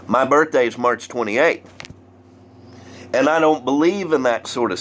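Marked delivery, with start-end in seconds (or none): none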